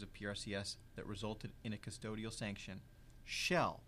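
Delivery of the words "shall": "shall" set apart by pauses and said louder; stress on shall. Real, not acted. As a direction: punch "shall" as a word on its own. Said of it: "I'm emphasizing the word shall".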